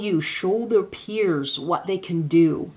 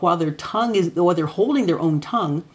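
usual speaking voice

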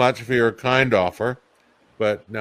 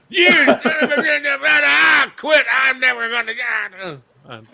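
much higher and louder, shouting with a laugh as the villain; bad-guy Santa rant; 13 semitones higher, 4 dB louder than usual. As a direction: rage like a cartoon villain who's been beaten. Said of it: "I was a bad guy".

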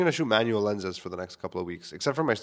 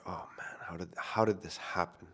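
whisper; 4 semitones lower, 8 dB quieter than usual. Retold or imitated. Retelling dismay during lost focus.

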